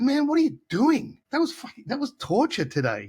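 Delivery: a touch of retold exasperation; exasperated complaint. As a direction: replay your frustration at the bar, hammed up. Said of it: "and I said".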